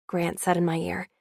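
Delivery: ordinary delivery, nothing marked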